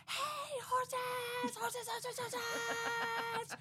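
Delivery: high, sing-song call to the horses; yelling at horses. Retold or imitated. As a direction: holler out the window at the horses. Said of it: "I start yelling".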